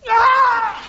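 sudden high falling shriek. Acted. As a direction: yelp as he tumbles. Falls down stairs.

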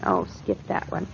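whispered aside. Real, not acted. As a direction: whisper it off lightly. Wife brushes off his teasing.

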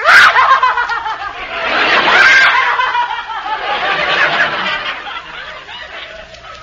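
long, high hysterical cackling wail; mock hysterical wail. Wife storms out with a long, hammed-up hysterical wail. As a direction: let out a drawn-out high hysterical howl. Wife's sham hysterical walkout.

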